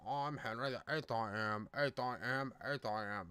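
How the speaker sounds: low, soft mumble with a motionless upper lip; stiff upper lip. Acted. Podcaster mimicking the rapper as Henry the Eighth, stiff-lipped mumble. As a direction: keep the upper lip still, chanting it low. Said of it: "His upper lip doesn't move".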